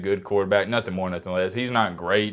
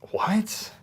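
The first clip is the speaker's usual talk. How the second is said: stunned what; 13 semitones higher, 3 dB quieter than usual. Retold or imitated. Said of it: "I said".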